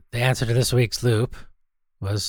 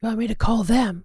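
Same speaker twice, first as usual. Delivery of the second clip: high silly sidekick voice; 11 semitones higher than usual. Acted. Sidekick voice continues.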